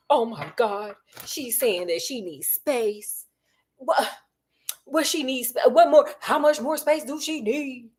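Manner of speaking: hammed-up whiny sob with a laugh breaking through; half-laughing fake blubbering; mock crying. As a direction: whimper like a man-child in a tantrum. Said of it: "Just, just crying".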